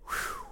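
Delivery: high, soft whistled-out sigh; mock sigh of relief; relieved whew. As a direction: imitate a caller letting out huge relief. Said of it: "And she said".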